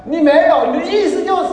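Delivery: high, loud mock accusation; accusing wife. Wife insisting on what her husband really meant, hammed-up accusation. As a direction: throw the denial back, loud and high-pitched. Wife hits back in acted quarrel.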